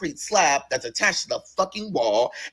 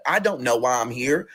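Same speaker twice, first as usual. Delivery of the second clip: ordinary talk, nothing marked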